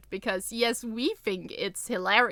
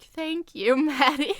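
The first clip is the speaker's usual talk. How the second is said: laughing thanks; 6 semitones higher, 6 dB louder than usual. Retold or imitated. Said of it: "was like".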